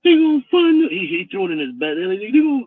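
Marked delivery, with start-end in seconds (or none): 0.0-0.9 high, loud wailing imitation; mock crying. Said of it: "he comes to the T V room crying". 2.2-2.6 high-pitched whining imitation; mock crying. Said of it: "he comes to the T V room crying".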